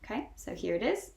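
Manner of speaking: ordinary delivery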